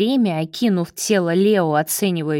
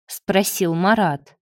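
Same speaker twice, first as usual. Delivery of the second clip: ordinary delivery, nothing marked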